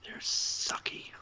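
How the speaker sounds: slow voiceless whisper. Real, not acted. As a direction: whisper it under your breath. Drunk cohost whispering aside.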